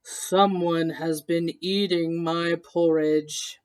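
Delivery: low, slow papa bear voice; Papa Bear rumbling deep about his porridge; great big voice. Acted. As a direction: boom it slowly like a big bear. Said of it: "in his great big voice".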